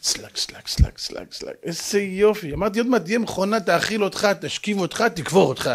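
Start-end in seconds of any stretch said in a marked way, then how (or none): 0.0-1.5 voiceless, rhythmic slapping "slak" sounds; soft repeated mechanical clacks; folding-machine noise. Acted. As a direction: imitate a machine folding clothes. Imitates a clothes-folding machine.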